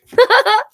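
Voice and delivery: short high delighted laugh; bright burst of laughter. Real, not acted. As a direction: let a quick laugh burst out, high and loud. Delighted at a positive card.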